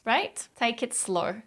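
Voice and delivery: ordinary delivery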